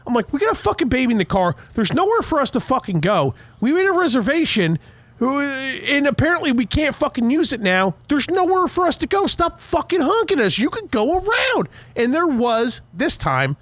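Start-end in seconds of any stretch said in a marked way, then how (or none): 0.3-11.7 high-pitched, exasperated mock rant; retold angry yelling. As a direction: climb higher as the retold argument builds. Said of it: "so I start fucking yelling at this guy".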